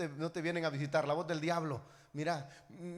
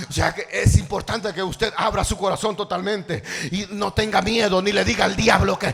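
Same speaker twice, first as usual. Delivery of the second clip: loud, urgent sermon delivery; fervent preaching; 4 semitones higher, 17 dB louder than usual. Real, not acted. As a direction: preach it forcefully to the congregation. (predicando con fuerza)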